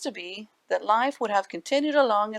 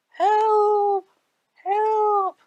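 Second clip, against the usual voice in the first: high, loud wail for help; wailing cry; 13 semitones higher, 8 dB louder than usual. Acted. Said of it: "as the voice rose to a wail".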